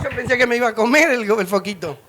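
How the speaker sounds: ordinary delivery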